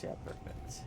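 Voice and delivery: soft unvoiced whisper. Shown as a demonstration. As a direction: whisper it under your breath. Repeats the same words as a whisper.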